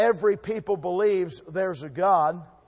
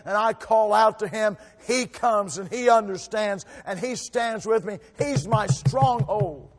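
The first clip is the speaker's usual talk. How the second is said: preaching that swells louder and slows to the last words; building preaching; 5 semitones higher, 7 dB louder than usual. Acted. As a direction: lift the church up to the climax.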